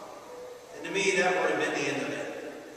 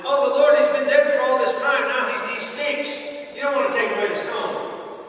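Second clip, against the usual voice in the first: protesting Martha; 5 semitones higher, 5 dB louder than usual. Acted. Preacher voices Martha's objection.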